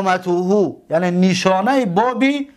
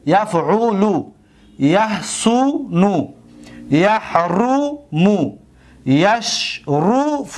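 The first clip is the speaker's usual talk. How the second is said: یفعل، یحسن، یحرم، یشرف slow, each middle "u" held long; یفعل، یحسن، یحرم، یشرف said syllable by syllable, vowel highlighted; stretched middle vowel; much slower than usual. Shown as a demonstration. Showing middle vowel of each verb.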